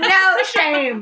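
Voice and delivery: "No shame" high and stretched with a laugh; emphatic repeat. Real, not acted. Marvelling at shameless men, drawn-out "No shame". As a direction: belt out each word of "No shame".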